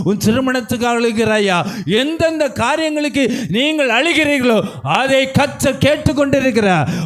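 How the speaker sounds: preaching that climbs in pitch and force; impassioned exhortation to a congregation; building sermon fervour. Real, not acted. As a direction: drive the questions home, building to a high pulpit cry.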